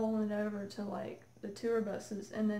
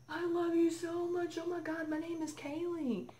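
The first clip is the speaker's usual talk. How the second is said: starstruck fan; 8 semitones higher than usual. Retold or imitated. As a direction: gush at your idol, starstruck. Starstruck fan meeting her idol.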